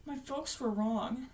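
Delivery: soft, slow, pained words; hushed admission. Acted. Bill on his parents' blame, hushed and slow. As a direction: drop to a quiet, slow confession.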